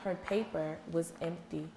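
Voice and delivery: slow, soft read-aloud narration; teacher slips into a quiet storytime voice; story read aloud. Acted. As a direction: read it to the class, hushed and slow.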